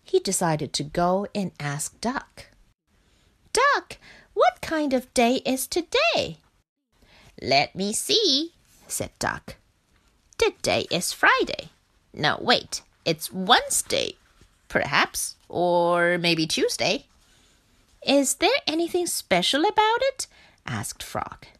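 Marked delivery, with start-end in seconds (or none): 3.5-6.4 lightly voiced small frog, higher; a curious little frog asking; Frog's voice. 7.4-17.1 a dithering duck thinking aloud; Duck's voice. Acted. 18.0-20.3 lightly voiced small frog, higher; a curious little frog asking; Frog's voice.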